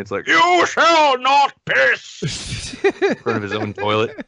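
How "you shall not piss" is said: Gandalf. Retold or imitated. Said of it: "There's this Gandalf limey".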